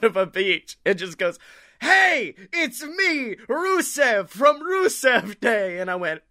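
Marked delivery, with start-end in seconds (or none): 1.8-5.8 high, slow hammy Bulgarian greeting; Bulgarian accent. Retold or imitated. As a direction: greet like a cheesy Bulgarian wrestler, pitched up. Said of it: "it's so, like, Bulgarian".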